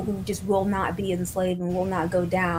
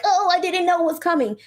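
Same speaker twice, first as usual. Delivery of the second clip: mock whiny high voice, pitched up; whiny excuse; 8 semitones higher than usual. Mocking a cowardly guy making excuses. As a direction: whine it like a coward caught off guard. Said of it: "be a little pussy and be like".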